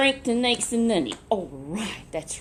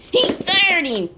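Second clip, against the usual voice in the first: much higher and louder, slow; emphatic outcry; 10 semitones higher, 8 dB louder, slower than usual. A narrator's reading. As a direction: hit the firing hard, slowly. Dramatic punchline to child.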